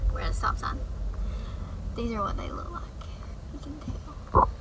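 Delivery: soft unvoiced whisper. Real, not acted. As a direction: whisper it close to the phone. Whispering while at a stop sign.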